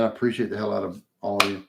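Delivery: ordinary talk, nothing marked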